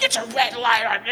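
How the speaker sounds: high-pitched mock scream; acted road-rage screech without full volume; retold screaming. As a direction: yell it like a garbled argument. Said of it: "You know, we're screaming".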